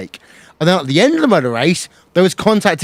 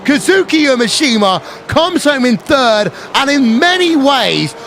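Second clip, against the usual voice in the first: excited race commentary, pitch climbing for the win; live play-by-play; 5 semitones higher than usual. Acted. Race announcer to viewers on the final lap, excited play-by-play.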